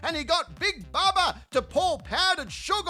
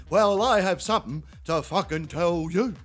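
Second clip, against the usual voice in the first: low, dopey bloke voice for the neighbour; Terry's voice; 9 semitones lower than usual. Retold or imitated. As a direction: drop into a dull, deep-ish mate voice. Neighbour Terry quoted over fence.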